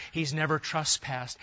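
ordinary talk, nothing marked

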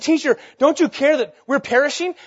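loud, high frightened cry; panicked plea. Acted. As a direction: shout it over the storm. Disciples' panicked plea retold.

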